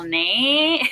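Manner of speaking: teasing "nay" lifted at the end; joking tag. Shown as a demonstration. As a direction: tack on a long, rising "nay", just kidding. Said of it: "in, like, a joking way".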